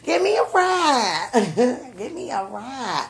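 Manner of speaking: high, laughing mock plea; playful begging. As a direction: beg through giggles, drawing out the words. Jokingly begging for a ride.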